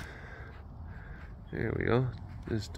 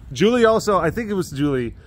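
jumps up high and loud on a sudden thought; remembering something with a burst; sudden excitement; 7 semitones higher, 5 dB louder than usual. Real, not acted. Telling viewers about Julie, suddenly excited.

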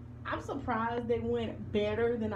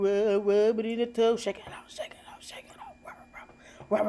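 whispering singsong; 3 semitones lower, faster than usual. Real, not acted. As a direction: whisper the bath chant to the dog. Whispered bath chant.

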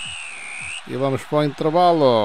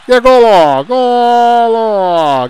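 much higher and louder goal cry; goal shout; 12 semitones higher, 9 dB louder, much slower than usual. Real, not acted. Goal call. (grito de golo)

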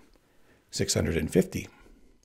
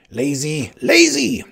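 scolding yell, leaping higher and louder; spurring shout; 6 semitones higher, 8 dB louder, slower than usual. A narrator's reading. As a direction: goad him on, second word shrieked. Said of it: "spurred the Sicilian".

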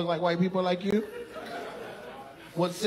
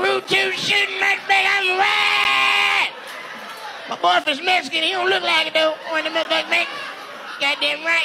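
mock woman's voice; 10 semitones higher than usual. Comedian imitates audience woman boasting about her boyfriend. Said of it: "She's like".

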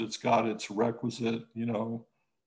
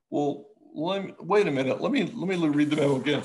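higher-pitched, louder mock second look; imitating caller's double-take; 4 semitones higher, 5 dB louder than usual. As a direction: play the caller stopping to check. Imitating caller rereading memo, flustered act.

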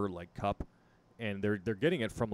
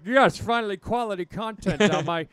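mock excited voice; 11 semitones higher, 14 dB louder than usual. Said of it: "I was like".

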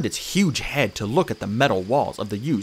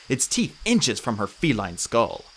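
plain reading voice

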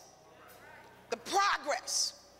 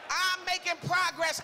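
bold, higher-pitched proclamation; bold declaration; 4 semitones higher than usual. Shown as a demonstration. Preacher showing the congregation how to say it, bold. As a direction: declare it boldly to the room. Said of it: "Say it like you bold".

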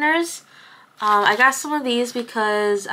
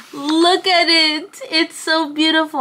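super excited; 7 semitones higher, 3 dB louder than usual. Real, not acted. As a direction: gush in real delight, stretching the words high. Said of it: "I am super excited about".

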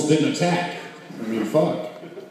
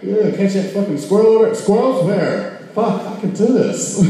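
mocking drunks; 9 semitones higher than usual. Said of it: "They'd be like".

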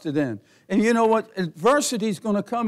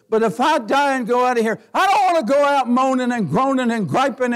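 loud, higher preaching voice building to a shout; full force preaching; 5 semitones higher, 4 dB louder than usual. Acted. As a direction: preach it out hard, climbing to a shout.

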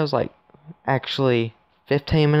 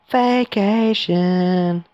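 drawn-out high exclamation; cheering at the start of a trip; excited cry; 7 semitones higher, 3 dB louder, much slower than usual. Pretend. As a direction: stretch it out, high and slow. Exclaims going to outer wall.

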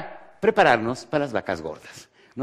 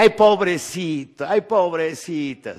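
slow, cooing pity for the poor thing; drawn-out cooing as if comforting a victim; hammed-up sympathetic coo; mock pity; 4 semitones higher, slower than usual. Imitating people pitying the victim.

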